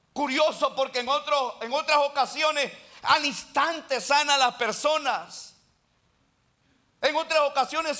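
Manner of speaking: loud, high fervent sermon delivery; full-force preaching. Real, not acted. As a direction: proclaim it from the pulpit, pressing every phrase.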